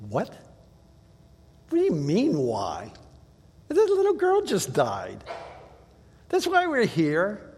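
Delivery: high, drawn-out disbelief with swooping pitch; incredulous mourners. Acted. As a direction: act out mourners baffled by an absurd question. Mourners' imagined reaction to Jesus' question.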